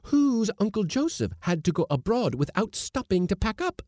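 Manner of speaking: high, loud accusing question; shrill, menacing taunt; dark demand. A narrator's reading. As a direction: fling the family scandal at him, high and loud. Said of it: "demanded Missus Blossom darkly".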